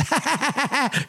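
high, breathy nervous cackle imitated; impression of the Henry Hill laugh, high and tittering; Ray Liotta laugh. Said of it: "Like, he's".

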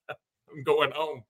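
slow words through laughter; laughing. Real, not acted. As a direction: chuckle it out slowly. Laughing at co-host's joke.

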